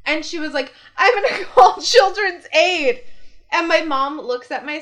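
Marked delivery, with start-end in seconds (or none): 1.0-3.0 mock childish threat, high and loud with a laugh; little kid threat. Sister threatening mom in a kid fight, mock tantrum. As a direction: imitate an upset little girl, pitched up. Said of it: "little kid fights where it's like".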